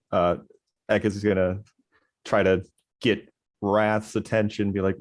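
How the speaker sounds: usual speaking voice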